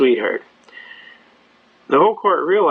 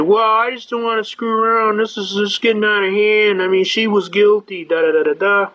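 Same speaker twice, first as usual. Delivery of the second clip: whiny cop; 5 semitones higher than usual. Retold or imitated. Cop protests to judge.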